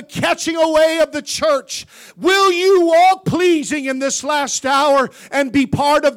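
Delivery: loud, high, rising revival preaching; preaching at full force. Acted. Preacher to congregation, full-voiced revival preaching.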